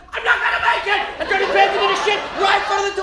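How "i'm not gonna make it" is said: higher, louder desperate wail; panicked cry. Retold or imitated. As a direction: cry out like you're about to lose it. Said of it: "and just went".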